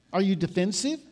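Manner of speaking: ordinary talk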